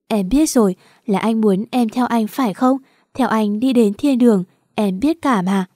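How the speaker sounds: usual speaking voice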